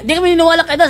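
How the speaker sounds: high, loud mocking atheist. Preacher imitating atheists who reject Adam, mock protest. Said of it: "sabi ng mga atheist".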